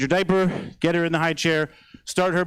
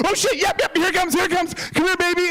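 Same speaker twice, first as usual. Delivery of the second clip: high-pitched, hurried dad panic; urgent bracing; 12 semitones higher than usual. Retold or imitated. Dad calling in his crying daughter, urgent high voice. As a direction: brace for the wail, pitched way up.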